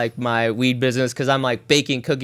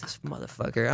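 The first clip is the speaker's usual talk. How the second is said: muttered; 3 semitones lower, 12 dB quieter than usual. Retold or imitated. Imitating gym people glaring.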